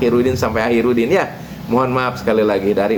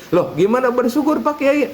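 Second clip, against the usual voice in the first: puzzled Udin; 11 semitones higher than usual. Acted. Udin protests to kiai.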